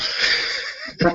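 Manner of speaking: a breathy, high-pitched burst of laughter; airy high laugh. Real, not acted. Host laughing at himself for being the old guy, airy high laugh.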